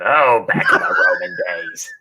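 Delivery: a hint of a put-on old lady; Barbara voice. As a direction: reminisce as Barbara. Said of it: "she reminisces".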